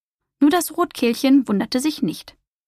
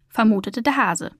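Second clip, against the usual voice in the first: usual speaking voice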